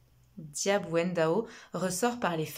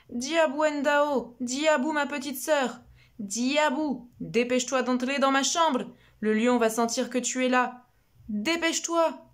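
brother calling urgently; 6 semitones higher, 5 dB louder than usual. Acted. Big brother calling his little sister inside, loud and urgent. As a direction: beg her to come in now.

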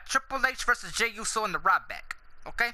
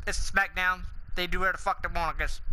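usual speaking voice

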